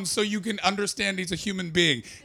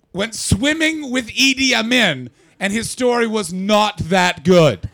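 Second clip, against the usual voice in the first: slow, loud, higher, punching each word; emphatic punchline; 5 semitones higher, 8 dB louder, slower than usual. Real, not acted. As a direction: hammer home the absurd fact.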